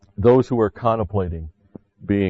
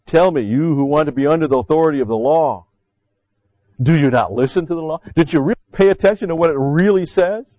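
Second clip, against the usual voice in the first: raised, loud preaching challenge; forceful preaching; 6 semitones higher, 5 dB louder than usual. Acted. Preacher challenging the congregation, loud and high. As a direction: press the question on the crowd.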